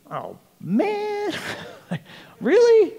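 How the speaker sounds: very high, squeaky disbelief; hammed-up whine leaping way up in pitch; mock disappointment. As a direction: play the letdown in a comic falsetto. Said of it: "very disappointed by this".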